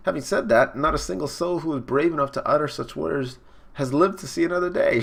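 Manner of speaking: lightly voiced ominous woman; warning lady villain. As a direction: deliver the warning in a high villainess voice. Praline voiced warning the captain.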